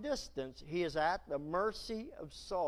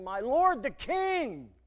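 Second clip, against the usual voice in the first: calling out, slow and louder; cried out; 6 semitones higher, 8 dB louder, slower than usual. Acted. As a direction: call across the distance to the king. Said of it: "he cried after Saul".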